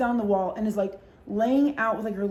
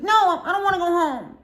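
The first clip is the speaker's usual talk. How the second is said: much higher, louder whine; whiny drunk friend; 9 semitones higher, 6 dB louder than usual. Retold or imitated. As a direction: whine like a drunk refusing bed.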